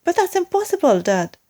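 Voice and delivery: high protest. Acted. Small monkey protests to father.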